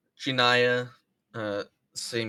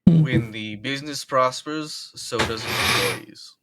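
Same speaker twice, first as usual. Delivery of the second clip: booming boss; 2 semitones higher, 7 dB louder than usual. Acted. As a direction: proclaim it grandly to the crew. Boss addressing the crew.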